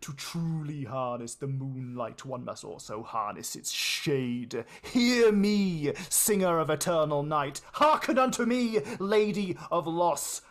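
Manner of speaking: loud, intoned sorcerer's chant; booming ritual invocation. Acted. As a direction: call out to the goddess with power.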